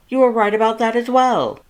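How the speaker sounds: high, loud, exasperated lecture; worried brother laying down the law; Papyrus scolding. A narrator's reading. Papyrus lectures Sans about drinking, high and loud.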